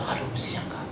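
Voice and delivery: hushed whisper of regret. Real, not acted. Pastor whispering his regret to the congregation, hushed. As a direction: breathe it out as a whisper.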